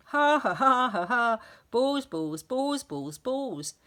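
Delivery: loud, high crazed laughter into chant; a teacher gone bananas; manic laughing cry. A narrator's reading. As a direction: cackle then chant madly. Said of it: "he cried".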